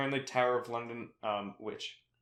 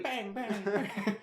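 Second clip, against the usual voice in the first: mock Toad gunfire; 9 semitones higher, much slower than usual. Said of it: "I like Toad going".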